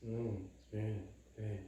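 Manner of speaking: slow, soft, half-swallowed mumbled yes; mock sulky kid mumbling yes; mocking a mumble. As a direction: mimic the child's reluctant mumble. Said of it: "뭐라고 하고 혼내는 것 같으면은".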